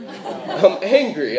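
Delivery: "I'm angry" stretched slowly through a laugh; laughing mock anger. Shown as a demonstration. Preacher joking about missing grandma's biscuits, laughing mock anger.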